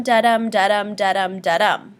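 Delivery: da-dum said weak then strong, like a heartbeat; steady iambic da-dum pulse; heartbeat rhythm. Shown as a demonstration. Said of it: "a beat that resembles the human heartbeat".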